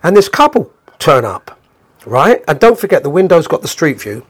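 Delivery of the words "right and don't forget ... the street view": usual speaking voice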